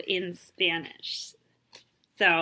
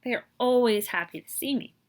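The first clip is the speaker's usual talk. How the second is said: ordinary delivery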